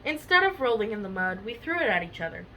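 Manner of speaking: ordinary delivery, nothing marked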